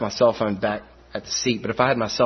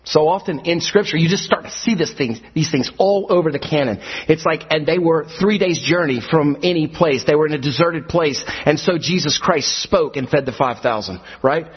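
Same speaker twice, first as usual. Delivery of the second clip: preaching building up; 7 semitones higher than usual. Acted. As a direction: build steadily to a full cry. Sermon building to climax.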